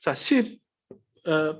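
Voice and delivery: normal talking voice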